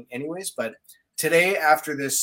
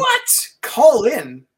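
startled high-pitched what; surprised; 10 semitones higher, 10 dB louder, much slower than usual. Real, not acted. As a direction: caught off guard, loud. Surprised by call-in idea.

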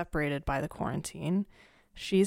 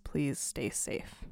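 slow, soft whisper; a tender whispered plea to stay safe; 5 semitones lower, 9 dB quieter, much slower than usual. Real, not acted. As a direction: breathe it gently, very slow. Closing plea to listeners.

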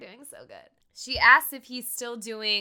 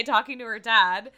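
usual speaking voice

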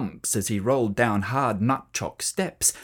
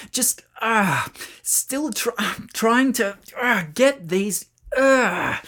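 halting strained words, higher; straining effort; 7 semitones higher, slower than usual. A narrator's reading. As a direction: push the words through effort. Boy struggling, tangled.

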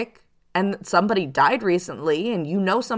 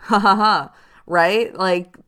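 ordinary talk

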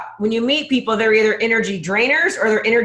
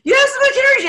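much higher and louder gushing; hammed-up admiring gush; imitating fans; 4 dB louder, much faster than usual. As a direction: squeal it like an admirer. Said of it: "I hear that all the time".

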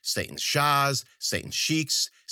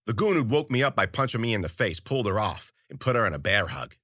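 ordinary delivery

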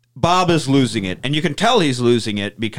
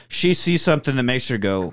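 normal talking voice

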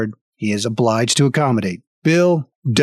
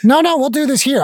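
pitched way up, a touch louder; high indignant refusal; 12 semitones higher, 3 dB louder than usual. Acted. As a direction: insist like a fussy artificer. Ham Jam refusing.

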